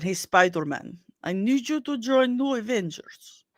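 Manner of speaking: hammed-up Russian accent; bad Russian accent. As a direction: put on a badly faked Russian accent. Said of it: "where she plays a bad Russian accent".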